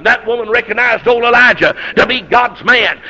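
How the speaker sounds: normal talking voice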